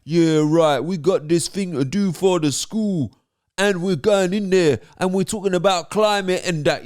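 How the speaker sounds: mock street accent. Narrator talking to schoolchildren, mock street accent. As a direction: put on a drawn-out urban London youth accent. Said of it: "is that really the best accent?".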